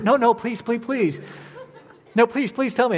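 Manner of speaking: hammed-up, hurried pleas; mock pleading. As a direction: beg playfully to be let in. Acting out eager begging for gossip.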